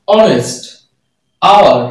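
"honest, hour" with the H left silent. Shown as a demonstration. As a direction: say "honest" and "hour" starting on the vowel, no H. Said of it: "এইচ এর উচ্চারণ কিন্তু এইচ হচ্ছে না". ("honest, hour" এইচ উচ্চারণ না করে)

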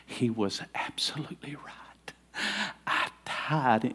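slow, breathy whisper of a changed man; a prisoner whispering his testimony to the preacher; hushed whisper. Retold or imitated. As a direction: let it out slowly in a whisper. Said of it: "and he says".

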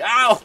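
loud startled ow; sudden loud cry of pain; sharp yelp of pain. Real, not acted. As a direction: yelp as if stung. Hit in game.

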